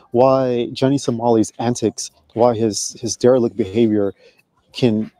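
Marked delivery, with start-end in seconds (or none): none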